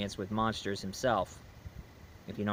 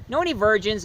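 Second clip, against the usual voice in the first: put-on asking the kid; 8 semitones higher, 3 dB louder than usual. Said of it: "And he said".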